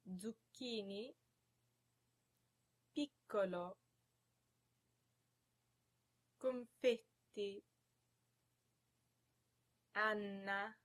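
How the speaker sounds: slow model words, ll, nn, mm and tt held; long double consonants. Shown as a demonstration. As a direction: pronounce each word for repeating, lingering on doubled consonants. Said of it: "more examples of words with double consonants".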